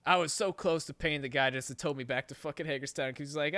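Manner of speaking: ordinary talk, nothing marked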